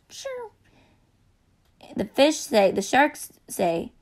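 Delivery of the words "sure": tiny high voice. Acted. California quiet squeak.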